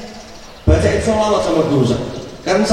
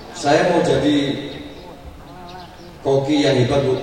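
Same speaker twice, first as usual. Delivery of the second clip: said flat; 6 semitones lower, 5 dB quieter than usual. Shown as a demonstration. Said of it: "Ngomongnya datar aja".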